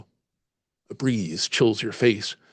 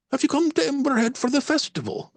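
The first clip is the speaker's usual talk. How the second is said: high, curious Irish lilt; Irish landlady voice; 14 semitones higher than usual. Acted. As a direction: ask brightly in an Irish accent. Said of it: "slight Irish lilt".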